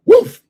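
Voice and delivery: a high, loud single woof like the dog; dog bark. Retold or imitated. Imitating his dog answering at the garage door, high loud bark. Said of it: "And he'd go".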